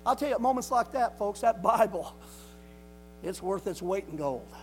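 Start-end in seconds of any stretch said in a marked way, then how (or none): 0.0-2.2 raised, higher-pitched sermon delivery, key words punched; fervent preaching. Real, not acted. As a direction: drive each point home to the congregation.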